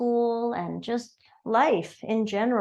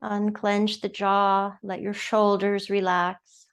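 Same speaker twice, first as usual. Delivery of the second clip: unhurried, soothing yoga-class guidance; guided meditation. Acted. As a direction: guide them gently into noticing the breath. Leads breathing meditation.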